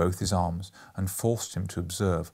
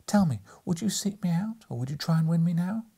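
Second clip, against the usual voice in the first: higher, steady questioning; mild but steady; 8 semitones higher than usual. A narrator's reading. Girl steadily challenges her lover, higher and mild. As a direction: ask him calmly and firmly. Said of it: "looked mildly, but with steadiness".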